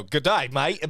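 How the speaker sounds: loud, hammed-up Australian greeting; exaggerated Aussie accent; mock Australian accent. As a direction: tease with a botched Australian accent. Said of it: "gonna make this the best fucking accent".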